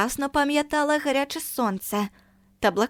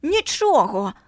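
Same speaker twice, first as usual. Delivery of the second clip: bellowing; 4 semitones higher, slower than usual. A narrator's reading. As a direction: bawl нічого at her. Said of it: "горлав він".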